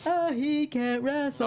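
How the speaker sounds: high, slow mocking whine; mocking critics. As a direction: sneer it like a doubter. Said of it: "la gente dice".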